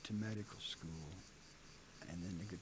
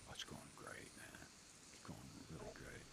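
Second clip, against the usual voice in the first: soft, lower, almost whispered reassurance; hushed; 10 semitones lower, 13 dB quieter than usual. Retold or imitated. Band leader dodging on the phone, soft and low. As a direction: murmur it like a shifty reassurance. Dave's evasive phone reply.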